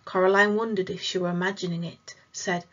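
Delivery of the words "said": ordinary delivery, nothing marked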